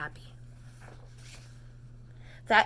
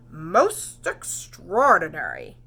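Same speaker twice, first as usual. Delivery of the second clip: slow, drawn-out, louder amazement; amazed exclaim; 3 semitones lower, 6 dB louder, much slower than usual. Acted. Doctor examining the stripes, slow amazed exclaim. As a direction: savour each syllable in wonder. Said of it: "he exclaimed".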